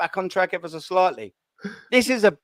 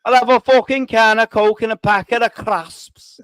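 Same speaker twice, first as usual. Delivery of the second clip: louder, hammed-up Scouse impression; terrible Scouse accent; 2 semitones higher, 5 dB louder than usual. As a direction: belt out a badly faked Scouse line. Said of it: "No, that's terrible".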